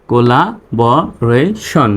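very slow "collaboration", a gap after each syllable; teacher's slow sounding-out. Shown as a demonstration. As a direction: break "collaboration" into co-la-bo-ra-shon. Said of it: "reading পড়ে আসি".

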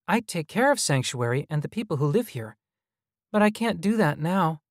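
put-on earnest high-pitched little boy; child hologram. A narrator's reading. Hologram explaining it cares for Sanctuary, high child voice. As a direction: speak up high like a child caretaker.

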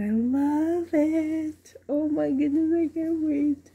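high, excited coo; thrilled gushing. Real, not acted. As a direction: gush high, barely able to wait. Gushing over the new doll.

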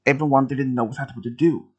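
plain reading voice

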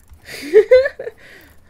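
loud high villain cackle; evil cackle. Acted. Streamer cackling like a villain over her drawing.

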